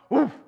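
single short "woof"; quick dog woof; dog bark. Acted. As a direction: imitate a dog saying yes with one bark. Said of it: "The dog barked".

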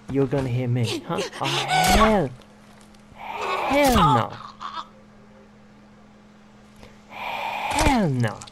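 very high, slow sassy diva voice; hammed-up sassy refusal. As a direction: play an outraged diva, stretching the words. Sassy voice, laughing.

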